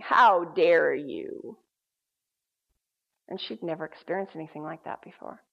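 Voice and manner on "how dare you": low, drawn-out demonic threat; deep menacing voice. Retold or imitated. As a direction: stretch it out slowly in a deep, menacing register. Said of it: "a deep, menacing voice saying".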